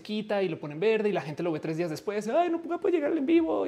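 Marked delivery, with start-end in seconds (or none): 2.3-3.7 whiny viewer. Retold or imitated. Viewer complaining, missed live stream.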